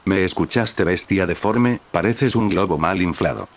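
ordinary delivery

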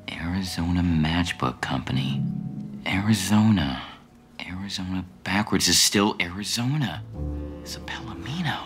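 hushed noir whisper. Acted. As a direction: whisper clues to yourself. Detective's whispered musing.

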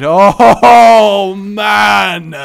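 loud laughing exclamation; delighted shout. Real, not acted. Player laughing at his run, loud delighted shout. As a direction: let it burst out with a laugh.

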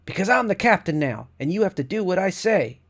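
put-on loud, high child's demand; defiant. A narrator's reading. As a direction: stand tall and command her. Girl aiming rifle at captive.

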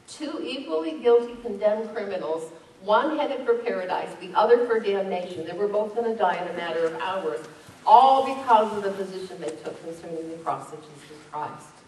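loud, emphatic pulpit delivery; forceful preaching. Real, not acted. Pastor contrasting two condemned criminals for his congregation, forceful preaching. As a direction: drive the point home loudly to the congregation. Preacher drives the point home.

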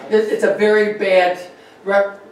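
normal talking voice

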